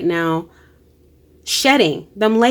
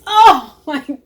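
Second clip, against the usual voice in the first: moved, emotional; 6 semitones higher, much slower than usual. Real, not acted. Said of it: "I don't know why I'm feeling emotional".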